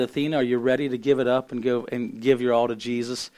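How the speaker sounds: ordinary talk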